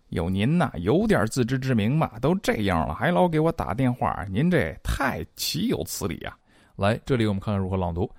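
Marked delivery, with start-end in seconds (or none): 0.1-6.4 higher, swooping, theatrical scolding; hammed-up indignation with exaggerated pitch swings; indignant telling-off. Singer telling off the caller who keeps phoning, indignant and hammed-up. Acting out annoyed reply to ex.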